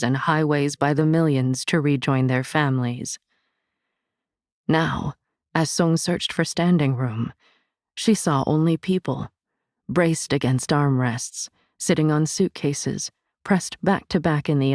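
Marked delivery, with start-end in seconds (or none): none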